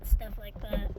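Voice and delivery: ordinary talk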